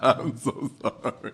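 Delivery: real helpless giggle breaking up the words; giggling. Real, not acted. Co-host apologising for laughing at the friend, giggling. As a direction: apologise through a fit of giggles. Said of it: "it makes me giggle".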